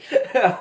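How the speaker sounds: sharp loud laugh at her own story; a quick, loud laugh breaks out; burst of laughter. Real, not acted. Laughing while telling of the bloody face.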